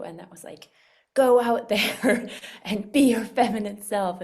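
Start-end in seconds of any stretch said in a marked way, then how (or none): 1.1-4.2 loud laughing mock command, pushy and brash; mock shouting. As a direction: bark it like a pushy slogan while laughing. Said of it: "that didn't scream at you".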